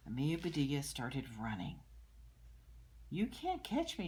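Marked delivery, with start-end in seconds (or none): 3.1-4.1 put-on high, loud taunting child; playful yell. Amelia running from the teacher, high playful yell. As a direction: call it out teasing, like a game. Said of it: "she yelled".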